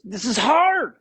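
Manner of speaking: high, loud mock yell of a struggling player; retold yell. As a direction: yell it like the drill is crushing you. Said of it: "he just yells".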